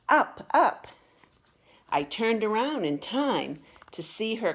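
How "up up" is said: put-on high, loud little girl's shout; child's yell. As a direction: call it out like a climbing toddler. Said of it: "I heard Sadie yell".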